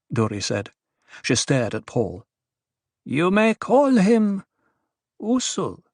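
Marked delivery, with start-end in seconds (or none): 3.0-5.8 high, very slow, deliberate woman's voice; Duri's character voice. Acted. Duri introduces Paul to the dwarf, high and deliberate. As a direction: draw it out, pitched high.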